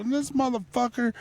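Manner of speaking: exasperated. Retold or imitated. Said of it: "I go".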